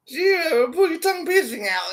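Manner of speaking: high mocking imitation of the woman; mocking woman's voice. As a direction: mimic her in a squeaky taunting voice. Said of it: "She's well violent".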